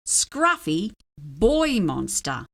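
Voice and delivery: ordinary delivery, nothing marked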